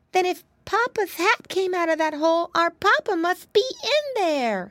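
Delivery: put-on high childlike piglet voice; a small pig working it out; little pig Flop, worried. Flop reasons aloud.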